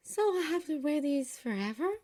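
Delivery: high, concerned question; puzzled worry. A narrator's reading. Girl asks sister about the magic shoes, puzzled worry. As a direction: ask it high, with a furrowed brow. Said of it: "brow furrowed".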